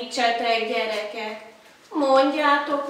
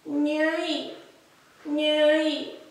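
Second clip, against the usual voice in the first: failed magpie call; 3 semitones higher than usual. Shown as a demonstration. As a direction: fumble the bird call. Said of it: "kitörik a nyelvem".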